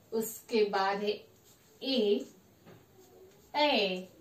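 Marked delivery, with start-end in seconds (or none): 1.8-4.1 ए drawn out, double the length of a short vowel; long vowel demo. Shown as a demonstration. Teacher showing students how long ए is held. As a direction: stretch ए to show a long vowel. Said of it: "दुगना समय ये कहने में हमें लग रहा है".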